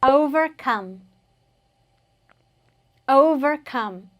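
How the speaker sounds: slow, measured model of OVERCOME; drawn-out teaching pronunciation; repeat-after-me demo. Shown as a demonstration. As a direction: say it slowly for learners to join in. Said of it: "Let's say that together".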